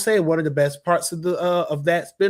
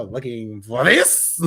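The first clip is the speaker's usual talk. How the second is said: slow, lower playful tease; Yzma line; 6 semitones lower, slower than usual. Retold or imitated. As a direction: dangle it teasingly like the villain. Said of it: "it's a Yzma thing".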